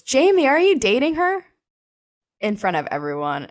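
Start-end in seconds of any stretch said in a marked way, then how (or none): 0.0-1.4 much higher, louder, taunting call across class; loud rude question. Retold or imitated. Rude classmate calls it out in front of the class, loud and taunting. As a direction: call it out for everyone to hear. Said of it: "just so loudly says".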